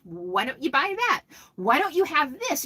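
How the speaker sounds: normal talking voice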